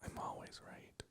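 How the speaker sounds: smug whisper. Acted. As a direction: whisper it like a secret boast. Quiet smug joke.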